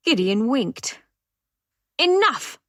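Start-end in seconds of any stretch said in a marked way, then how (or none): 2.0-2.6 sharp snap. Acted. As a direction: bite off the word, a bit louder. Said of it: "snapped the Reverend Daughter".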